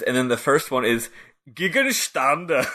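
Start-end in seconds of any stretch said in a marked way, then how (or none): 1.5-2.6 exaggerated German pronunciation, slow; mock German accent, drawn out; fake German. Shown as a demonstration. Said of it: "I'm just doing a fake German thing".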